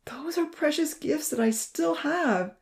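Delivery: slow, high, emotional insistence; heartfelt and drawn out, pitched high; fervent. A narrator's reading. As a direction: say it fervently, close to tears. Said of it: "Fervently he expressed".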